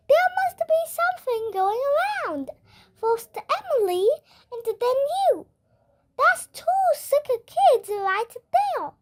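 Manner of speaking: a cheery school nurse asking; lightly voiced friendly nurse; Missus Cooney voice. A narrator's reading.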